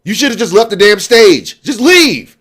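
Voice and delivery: high, exasperated yell; angry. Real, not acted. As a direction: order him off in anger. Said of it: "trying to contain my anger".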